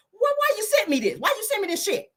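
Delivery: an outraged mother on the phone; imitating your mama. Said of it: "your mama done called your ass like".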